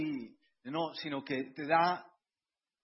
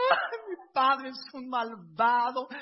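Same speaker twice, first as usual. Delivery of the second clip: high, loud, slow mock wail of a sulking girl; mock crying daughter; 6 semitones higher, 7 dB louder, slower than usual. Father imitating his crying daughters, high mock wail. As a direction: ham up a daughter's tearful complaint. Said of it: "estuvieron llorando como Marías".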